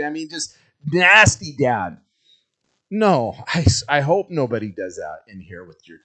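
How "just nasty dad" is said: ordinary talk, nothing marked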